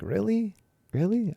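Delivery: high, disbelieving "Really? Really?"; offended "Really? Really?" in a high voice; incredulous. Retold or imitated. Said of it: "I was just like".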